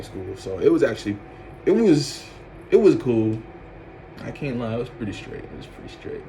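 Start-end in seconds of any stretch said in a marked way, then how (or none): 4.2-5.4 soft aside. Real, not acted. Wrapping up the story. 5.4-6.2 whispered. Real, not acted. Summing up the ex to viewers, whispered.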